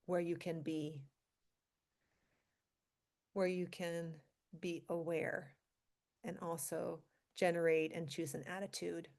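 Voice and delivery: calm meditation guide with long pauses; guided meditation. Acted. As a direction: ease them gently into stillness, leaving space between phrases. Leads a centering meditation.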